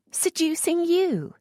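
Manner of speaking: high, drawn-out shocked repeat; startled echo. A narrator's reading. Lisette to the earl, high startled echo. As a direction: caught off guard by his charge.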